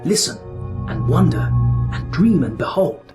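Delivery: usual speaking voice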